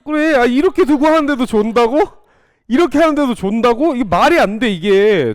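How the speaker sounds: high, loud disbelief that they still doze; can't believe anyone sleeps through this; incredulous. Real, not acted. Teacher to dozing students, loud high disbelief.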